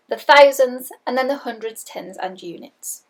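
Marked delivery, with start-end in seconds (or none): none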